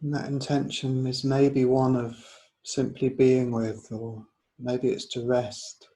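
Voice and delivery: unhurried yoga nidra guidance; guided meditation. Real, not acted. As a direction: guide them gently inward, leaving space between phrases.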